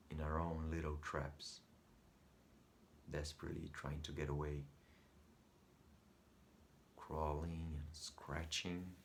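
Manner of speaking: soft, halting musing with long pauses; quiet brooding. Acted. Lonely son confides his thoughts on being trapped, quiet brooding.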